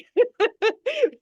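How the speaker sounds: a real, delighted laugh; spontaneous laugh at the chat; high burst of laughter. Real, not acted. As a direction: laugh out loud, high and bright. Host laughing at chat joke.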